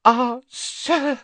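high, loud breathy elf gasp; high gasping elf cry. A narrator's reading. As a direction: catch your breath in awe. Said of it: "he gasped".

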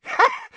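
high, shrill witch cackle; triumphant cackle. A narrator's reading. Bellatrix mocking Snape's promise with a cackle. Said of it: "a cackle of triumphant laughter".

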